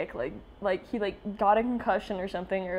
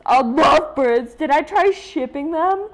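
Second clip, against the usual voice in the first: delighted gasp; 6 semitones higher, 10 dB louder than usual. Real, not acted. Reacting to comic, excited.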